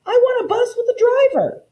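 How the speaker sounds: put-on high-pitched childish shout; panicked girl yelling to be let off. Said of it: "I shouted".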